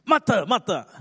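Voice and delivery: high, loud, scolding call of the name; angry voice. Shown as a demonstration. The Lord imagined rebuking Martha, angry voice. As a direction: snap the name twice in rebuke. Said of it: "in an angry voice?".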